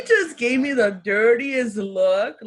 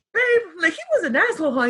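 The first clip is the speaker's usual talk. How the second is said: laughing disbelief; 6 semitones higher, faster than usual. Retold or imitated. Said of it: "But I'm like".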